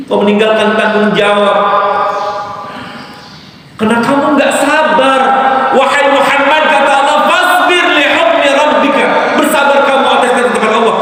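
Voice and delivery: high, rising, forceful sermon voice; preaching at full force. Acted. Preacher thundering God's rebuke to the congregation, fervent. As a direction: drive the rebuke home, calling out.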